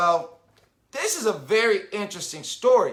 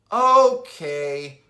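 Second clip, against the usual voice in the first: sigh; 6 semitones lower, 4 dB louder than usual. A narrator's reading. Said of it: "my sister sighs".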